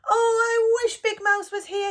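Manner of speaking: high, tearful little mouse wish; crying. A narrator's reading. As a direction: whimper it near tears. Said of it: "he cried".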